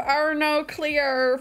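hammed-up Australian vowels; Aussie accent. Streamer warning chat of the one phrase, mock Aussie. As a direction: quote it in a broad mock Aussie. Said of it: "It's just gonna be a lot of".

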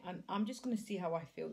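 ordinary delivery

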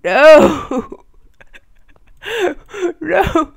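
loud, high wailing no; despairing no. Real, not acted. Gamer as the game crashes, wailing no. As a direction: cry out in despair.

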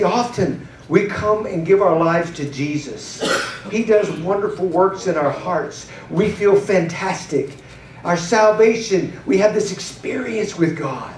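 normal talking voice

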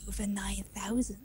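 breathy whispered meme line. Acted. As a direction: whisper it like a secret.

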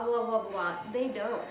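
ordinary talk

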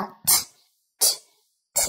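t as a breathy puff with no vowel after; just a released t, no "tờ"; voiceless English t; aspirated t. Shown as a demonstration. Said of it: "người bản xứ sẽ đọc là".

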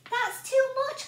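high, loud alarmed cry. A narrator's reading. Boy crying out as porridge fills the room. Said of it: "cried the boy".

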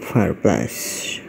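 ordinary delivery

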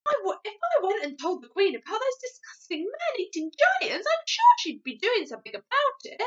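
put-on high, loud, eager child character; Sophie. Sophie persuading the giant, eager high voice. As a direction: urge the plan with excitement.

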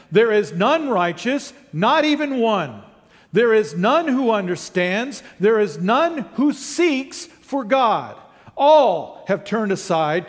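high, deliberate Bible reading; emphatic scripture reading. Real, not acted. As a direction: declaim each verse, punching the key words. Reads scripture aloud emphatically.

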